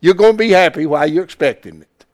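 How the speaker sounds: ordinary talk, nothing marked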